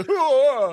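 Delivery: a big roaring death cry, loud and high; crushed father's roaring death cry. Retold or imitated. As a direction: bellow it like a man being crushed. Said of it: "like this death cry".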